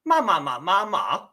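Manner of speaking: ma, ma, ma, ma, ma each on a different Mandarin tone; 用声调说问句; Mandarin tone sentence. Shown as a demonstration. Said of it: "this question would sound like".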